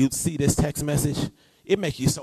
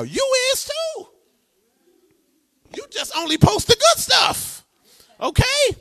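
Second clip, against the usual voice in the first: playful sing-song tease; 5 dB louder than usual. Acted. Teasing congregation.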